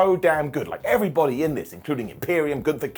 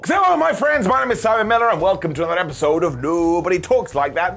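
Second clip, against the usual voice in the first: announcer intro; 3 semitones higher, 4 dB louder than usual. Acted. Vlogger opening his mock show segment, hammed-up announcer voice. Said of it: "show segment intro".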